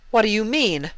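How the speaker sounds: high, puzzled demand; confused Luna. Acted. Luna asking her sister what she means, high and confused. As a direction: press her for an answer.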